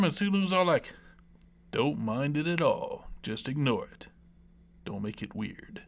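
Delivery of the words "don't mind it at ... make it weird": Sulu. Acted. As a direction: sink into a bad, low impression. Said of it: "See, I did the voice and it wasn't good".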